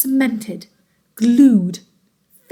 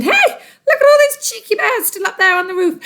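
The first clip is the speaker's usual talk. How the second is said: gleeful laugh; 10 semitones higher than usual. Acted. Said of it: "Missus Twit said".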